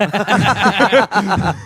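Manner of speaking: laughing. Real, not acted. Laughing at friend.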